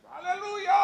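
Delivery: very high imitated shout; teriak haleluya. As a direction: cry out in mock jubilation. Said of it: "Dia teriak gitu". (meniru teriakan bernada tinggi)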